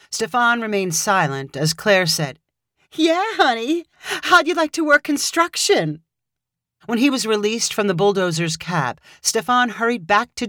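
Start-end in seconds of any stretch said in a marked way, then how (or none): 2.9-6.0 higher, playfully mocking; teasing. A narrator's reading. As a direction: tease him sweetly. Claire teasing her husband.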